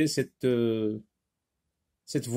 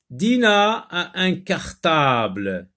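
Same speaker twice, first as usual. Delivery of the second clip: slow, raised and loud classroom reading; model reading aloud; 4 semitones higher, 5 dB louder, slower than usual. Shown as a demonstration. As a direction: draw the sentence out word by word. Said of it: "Relis les phrases".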